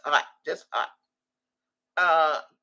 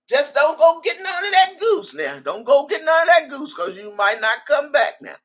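high, loud teasing warning to stay away from the goose; playful warning; 6 semitones higher, 5 dB louder than usual. Real, not acted. Teasing a chatter about the goose, playful warning.

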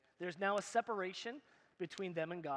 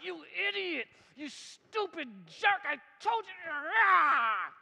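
high, loud furious yelling, hammed up; mock angry God shouting; 9 semitones higher, 8 dB louder, slower than usual. Preacher mocking an angry God at Adam, shouting. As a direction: rant like a fed-up, insulting God. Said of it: "That's not what happened".

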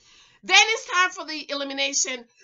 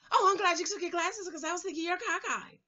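softer mock voice, unlike your own; mocking imitation; 3 semitones higher, 6 dB quieter, faster than usual. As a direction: parrot her remark in mockery. Mocks what Simone was told.

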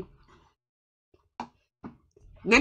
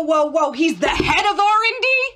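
alarmed; 4 semitones higher, 5 dB louder than usual. Acted. Alarmed on hearing the news.

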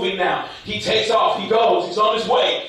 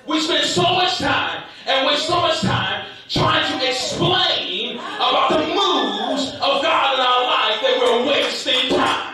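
stretched, emphatic pulpit phrases; forceful preaching; 5 semitones higher than usual. Real, not acted. Preacher warning believers about wasting time, forceful.

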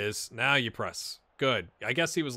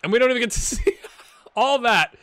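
laughing; 10 semitones higher, 9 dB louder than usual. Real, not acted. Laughing at missing the free kick.

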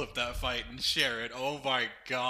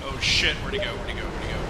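ordinary talk

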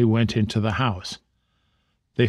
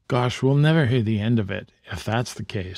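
ordinary delivery

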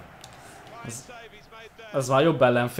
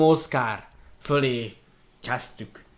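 normal talking voice

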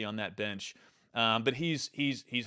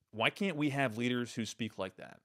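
normal talking voice

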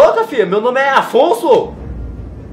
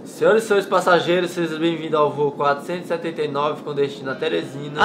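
airport announcement; 7 semitones lower, 8 dB quieter than usual. Acted. Pilot announcement on plane.

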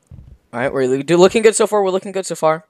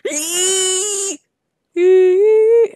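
high squeal; 7 dB louder than usual. Acted. Screeching while swinging.

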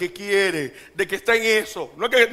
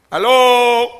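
loud call; 3 semitones higher, 11 dB louder than usual. Real, not acted. Calling to the congregation.

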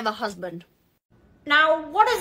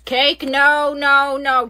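ordinary delivery

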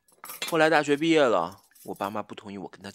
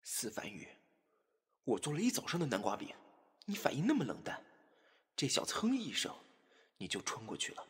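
jealous muttering; 10 dB quieter than usual. Acted. Spurned cook grumbling alone about Fanyu, jealous muttering. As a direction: mutter your grievance under your breath.

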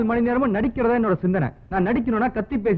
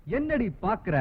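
normal talking voice

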